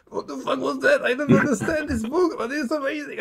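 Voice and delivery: very high-pitched imitation of a stunned young fan; acting out giddy bafflement; retold amazement. Retelling own amazed reaction to book.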